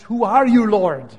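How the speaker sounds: Saul's loud, startled question; awed cry to the Lord. Acted. Saul struck down on the road, calling out in a loud startled voice.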